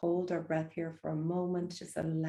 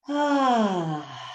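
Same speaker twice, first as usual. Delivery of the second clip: loud open sigh out; dramatic sigh; 3 semitones higher, 11 dB louder than usual. Shown as a demonstration. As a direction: exhale with a big sigh. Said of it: "exhale with a big sigh".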